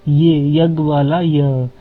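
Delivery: ये यग वाला य slow and drawn out; slow classroom sounding out of य; slow teaching demo. Shown as a demonstration. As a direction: spell out ये and य slowly. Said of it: "लेकिन वो पढ़ते इसको ये हैं".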